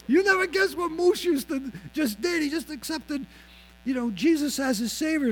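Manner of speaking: high, thrilled announcing; gleeful share-the-news call; excited. Retold or imitated. Friend phoning another guy, excitedly. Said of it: "after he got all excited".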